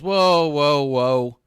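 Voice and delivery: loud, drawn-out whoa to hold him back; mock alarmed whoa. Ryu stopping Joe from beating the champions, loud whoa. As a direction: call out whoa like stepping between them. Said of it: "And Ryu goes".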